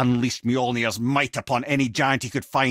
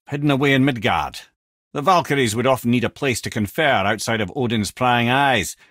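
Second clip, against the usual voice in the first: ordinary talk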